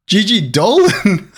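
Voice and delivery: high, slow, laughing reveal; thrilled, high-pitched exclamation; delighted surprise. Real, not acted. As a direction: announce a lucky pull with a laugh. Surprise card reveal, then laughs.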